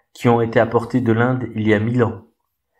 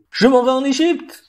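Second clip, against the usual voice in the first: much higher, ringing shout; excited cry; 14 semitones higher than usual. A narrator's reading. Sparrow crying out to the sailors, very high and bright. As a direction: announce it to the whole port, up high. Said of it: "cria le passereau".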